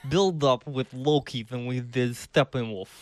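slow, lightly put-on Russian accent; mock Russian accent. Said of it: "You sound more like [name] now".

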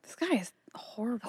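hushed whisper; very soft, voiceless aside. Real, not acted. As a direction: whisper it like a secret verdict. Hushed aside during friend's story.

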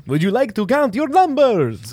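high, slow Transylvanian Count voice; Transylvanian accent. Retold or imitated. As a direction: put on a Transylvanian vampire accent. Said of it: "Transylvanian accent".

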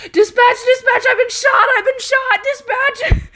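high, loud frantic cry for help; imitated panicked shouting. Shot officer radioing dispatch, imitated panicked shout. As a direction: act out an officer freaking out. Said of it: "freaking the fuck out".

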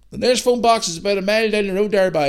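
put-on high rural Northern Irish accent; country accent. Farmer pointing lads to a phone box, country accent. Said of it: "country accent".

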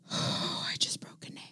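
hushed, deflated whisper. Real, not acted. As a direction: breathe it out, barely voiced. Sighs, admits a small mishap.

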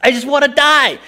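high, loud despairing wail; whiny shout. Retold or imitated. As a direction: cry out that you're done with it all. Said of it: "And again, I whined".

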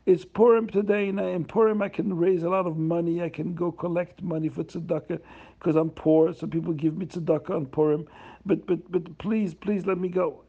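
high, wailing plea to be let go; begging through tears, lightly acted; poor man pleading with Reb Chaim not to jail him; crying plea. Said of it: "The poor man starts crying".

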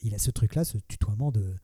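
ordinary delivery

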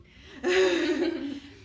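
sudden loud burst of laughter; laughing. Real, not acted. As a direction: laugh out loud, caught off guard. (carcajada fuerte y repentina)

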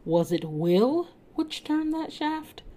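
slow high query; hesitant question. A narrator's reading. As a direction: ask it in a high, wondering voice. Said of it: "then asked".

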